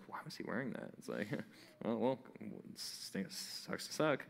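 hushed gossiping behind his back; mock whisper. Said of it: "they whisper to their friends".

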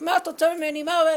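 mock the man's yelling, loudly; indignant mock yell; retold shouting. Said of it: "והתחיל לצעוק".

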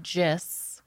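"gis" said as one word with a J sound; mispronounced GIS. Retold or imitated. Job candidate to interviewers, mispronouncing GIS as "gis". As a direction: say "gis" as one syllable, like "jiss". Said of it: "it's never called gis".

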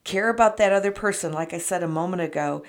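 usual speaking voice

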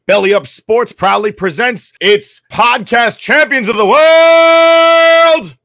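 booming ringmaster announcement, drawn out. Acted. Ringmaster-style podcast intro.

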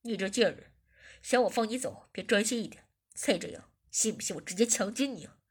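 low, loud, menacing boss voice; annoyed. A narrator's reading. Boss threatens the captive, low and harsh. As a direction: growl a threat down at her. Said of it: "我有点生气地说".

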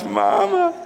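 put-on high little-boy wail for mother; high-pitched mock cry; scared child. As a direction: imitate a frightened kid fleeing to mom. Imitates his son running to mother.

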